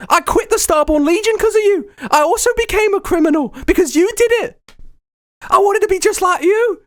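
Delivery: very high, put-on excited voice; starstruck superfan. Fan tells idol he turned pirate too, high gushing voice. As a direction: gush to a long-lost idol in high pitch.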